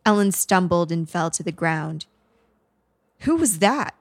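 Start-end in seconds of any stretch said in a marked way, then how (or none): 3.2-3.9 high-pitched alarmed demand; a contained, narrated scream; mild scream. A narrator's reading. Killer on the phone hears a stranger, mild high scream. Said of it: "Douglas screamed".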